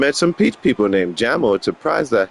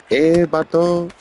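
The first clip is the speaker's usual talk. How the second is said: put-on playful Chicano homeboy accent; mock Chicano street talk; Chicano vato; 3 semitones higher than usual.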